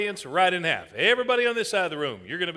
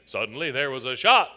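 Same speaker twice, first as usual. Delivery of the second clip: low-pitched storyteller cue; dramatic lowered narration; ominous story narration; 9 semitones lower than usual. Shown as a demonstration. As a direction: sink low to set up the shot. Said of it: "I'm gonna say these words".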